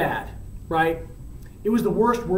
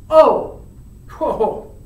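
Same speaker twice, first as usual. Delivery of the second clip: acted jolt of surprise; startled realization; 7 semitones higher, 5 dB louder than usual. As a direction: caught out, suddenly remembering. Romeo suddenly remembers the party.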